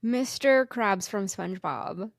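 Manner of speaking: usual speaking voice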